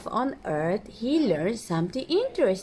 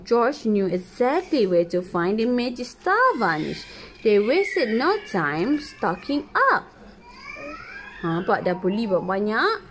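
ordinary delivery, nothing marked

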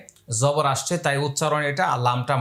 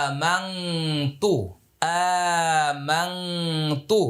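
আমানতু drawn out slowly for repeating; slow clear model; much slower than usual. Shown as a demonstration. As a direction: say আমানতু slowly for learners to copy. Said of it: "লক্ষ্য করুন". (শেখানোর জন্য ধীরে আমানতু)

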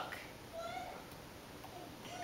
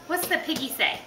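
ordinary delivery

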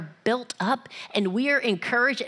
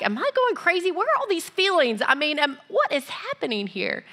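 higher, puzzled rush; higher panicky self-question; mock bewilderment; 7 semitones higher than usual. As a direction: act confused and alarmed. Confused feeler's inner voice.

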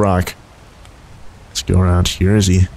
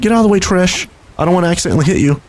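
usual speaking voice

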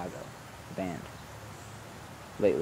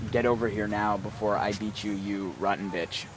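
low, softer mock threat; 4 semitones lower, 6 dB quieter than usual. Voicing what the dog whistle means, low mock threat. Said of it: "my whistle of".

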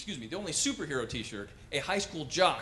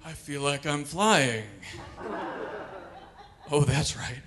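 stoned Superman; slower than usual. Acted. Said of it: "strung out on marijuana".

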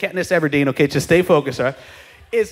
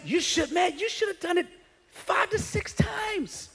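higher, softer, disbelieving; incredulous rebuke; 8 semitones higher, 8 dB quieter than usual. Retold or imitated. Prophet rebukes the king, incredulous.

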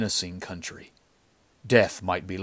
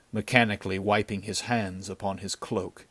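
ordinary delivery, nothing marked

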